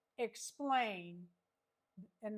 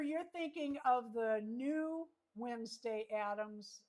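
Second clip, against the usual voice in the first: normal talking voice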